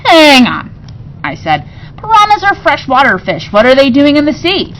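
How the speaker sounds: eager interruption. Real, not acted. Child interrupts father.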